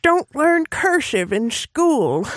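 put-on slow, high creaky old grandpa. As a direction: grumble like a cranky grandpa. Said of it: "Gotta put my grandpa voice on".

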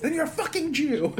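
high-pitched mock outburst through laughter; retold screaming. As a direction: imitate an exasperated scream, half laughing. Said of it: "going from screaming at him".

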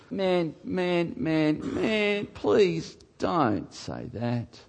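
hushed, dismayed appeal; pained pleading. Real, not acted. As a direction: beg them gently, drawing out the last words. Pained plea to such parents.

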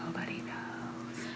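slow mock whisper, barely audible; conspiratorial whisper. Husband to wife leading church music high, secretive whisper.

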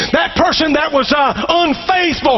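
rising sermon call; building preaching. Acted.

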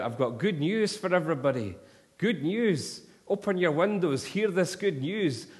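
imitating the minister shouting good news from the street corner; retold shout. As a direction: call it out to the flats at full voice. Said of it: "at the very top of his voice, shouted out".

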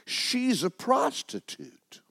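high, slow, stretched-out retort; acted retort. A narrator's reading. Mother answers her pastor about the daughter, acted retort. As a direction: throw it back in a high pitch, word by word. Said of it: "She retorted".

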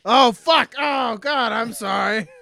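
loud, high mock-horrified apology; acted panic. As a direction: blurt it out as if you just shot someone. Acts out accidental shooting.

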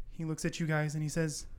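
normal talking voice

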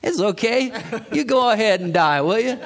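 high, loud, laughing mock delivery; loud, high laughing parody; mock blunt message. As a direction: ham up an absurdly blunt bedside message. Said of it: "Can you imagine?".

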